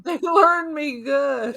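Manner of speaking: slow, high, drawn-out yokel. Pretend. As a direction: play it dim and proud of it. Joke about bad grammar.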